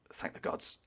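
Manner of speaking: breathy whisper of relief; relieved whisper to himself. Acted. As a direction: murmur it under the breath, thankful. Fabat's muttered relief.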